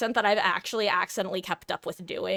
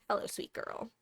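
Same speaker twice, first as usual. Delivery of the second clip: soft pet talk; 11 dB quieter than usual. Real, not acted. Greeting her pet softly.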